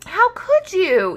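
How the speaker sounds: high, slow, loud reproach; a dino yelling at the Minosaur; upset yell. Acted. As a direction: yell it at the tower wrecker. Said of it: "yelled Iguanodon".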